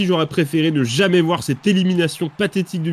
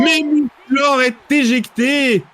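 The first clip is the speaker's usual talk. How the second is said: excited shout; 7 semitones higher, much slower than usual. Real, not acted. Wrestling elimination called out.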